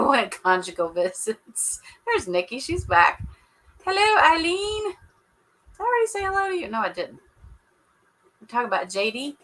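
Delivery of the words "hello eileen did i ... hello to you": cheerful greeting. Real, not acted. Greeting a viewer in chat.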